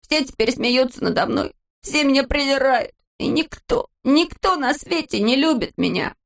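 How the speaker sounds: high-pitched, self-pitying wail; tearful lament. A narrator's reading. Neznaika crying alone at the fence, tearful lament. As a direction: lift the voice into a miserable complaint. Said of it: "и слёзы закапали из его глаз".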